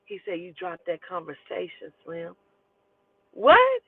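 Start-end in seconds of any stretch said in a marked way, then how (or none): 0.4-2.4 smooth pickup line. Retold or imitated. Older guy to teen girl, smooth soft pickup line. 3.3-3.8 high, loud disbelief; shocked what. Real, not acted. Girl reacting to a pickup line, shocked high.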